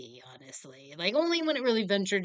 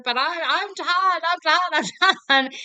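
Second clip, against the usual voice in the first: screaming; 9 semitones higher, 10 dB louder than usual. Retold or imitated. Son screaming his name back at his mother, mock scream. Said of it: "start screaming that at each other".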